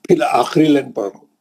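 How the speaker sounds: ordinary talk, nothing marked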